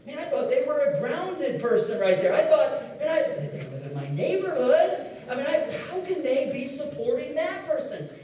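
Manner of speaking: half-laughing snobbish musing; smug disbelief. Pretend. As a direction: act puzzled and superior about the neighbor's sign. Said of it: "and you're like".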